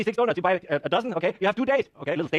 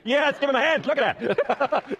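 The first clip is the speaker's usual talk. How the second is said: excited cheer; 9 semitones higher, 3 dB louder, much slower than usual. Real, not acted. Applause for the volunteer.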